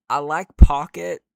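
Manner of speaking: very loud emphatic claim. Real, not acted. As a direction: say it loud and firm.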